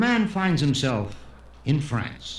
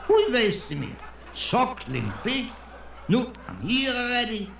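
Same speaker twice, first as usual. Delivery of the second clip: much higher, questioning, stretched-out echo; incredulous diner; 10 semitones higher than usual. Acted. Customer reacts to the waiter offering suckling pig, incredulous. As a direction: weigh the temptation aloud in a high pitch.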